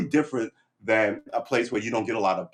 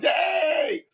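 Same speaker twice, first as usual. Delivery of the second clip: very high, loud mock cheer; crowd cheer; 5 dB louder than usual. Crowd cheering the compromise, high and loud shout. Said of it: "Everybody goes".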